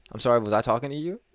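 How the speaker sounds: imitating rude customer. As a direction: put the helper in their place, fast. Rude customer retold snapping at him.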